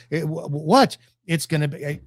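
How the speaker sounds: normal talking voice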